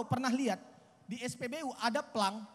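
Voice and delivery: ordinary talk, nothing marked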